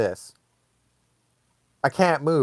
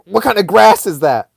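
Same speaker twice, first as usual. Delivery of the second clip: loud, raised mock demand; punchy, higher indignant question; pissed-off mom; 5 semitones higher, 7 dB louder than usual. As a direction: imitate an angry mom interrogating. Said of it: "She was pissed".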